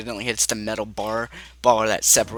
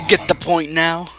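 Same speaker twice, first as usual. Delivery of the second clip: higher, slow, deliberate question; pointed threat; 4 semitones higher, 4 dB louder than usual. Acted. Game master voices character releasing the player, slow pointed threat. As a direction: spell it out slowly and pointedly. Repeats threat after letting go.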